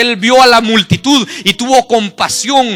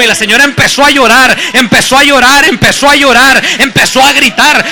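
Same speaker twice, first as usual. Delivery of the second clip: loud, higher-pitched fervent preaching; predicación a toda voz; 4 semitones higher, 8 dB louder than usual. Acted. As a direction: preach the testimony at full force, driving each phrase out. Preaches the testimony at full force. (predicación ferviente a gritos)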